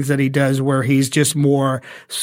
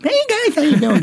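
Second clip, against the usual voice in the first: high lisping Jay Leno voice; Jay Leno impression; 12 semitones higher than usual. Shown as a demonstration. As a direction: Leno greeting with a lisp. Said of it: "he's got a little bit of a lisp".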